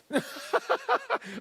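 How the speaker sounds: a short, high mocking laugh; a derisive laugh at the lord's warning; scornful laugh. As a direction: laugh off the threat with contempt. Mint master mocks the threat.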